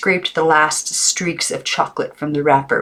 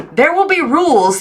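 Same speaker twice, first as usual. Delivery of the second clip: high, loud commanding aunt; stern aunt; 12 semitones higher, 5 dB louder than usual. A narrator's reading. Aunt announcing rules to Maggie, high and stern. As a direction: lay down the law as the strict aunt. Aunt voiced in read story.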